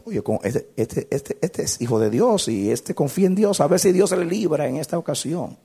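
high, loud voice of a taunting devil. Acted. Devil taunting a believer about God rescuing him, high mocking delivery. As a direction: jeer like the devil, rising and building. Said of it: "Y el diablo está diciendo".

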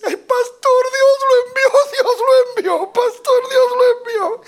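shrieked mock sobbing, very high and loud; imitated tearful wailing. Preacher imitating a church sister greeting him at the door, wailing. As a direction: cry out hysterically in a put-on high voice.

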